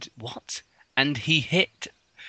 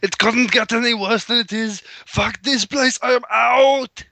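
high, loud put-on voice; hammed-up shout, stretching the last words; loud Arnold outburst; 11 semitones higher, 6 dB louder than usual. Arnold pulling metal from his ribs, exasperated shout. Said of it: "while saying out loud".

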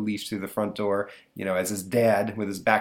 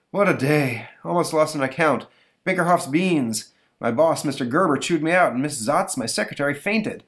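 weary dad; 6 semitones higher, 4 dB louder than usual. Acted. Tired dad after work.

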